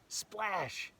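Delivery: plain reading voice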